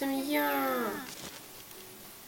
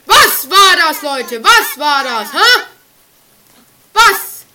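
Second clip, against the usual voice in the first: scared horror game streamer; 3 semitones higher, 17 dB louder, slower than usual. Real, not acted. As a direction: shout in shock at a sudden blast. Sudden blast in game.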